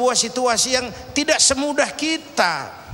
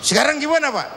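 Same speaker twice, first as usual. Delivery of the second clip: curious son; 5 semitones higher, faster than usual. Acted. Son asking his father what to do next, high and eager. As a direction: ask like an eager young son.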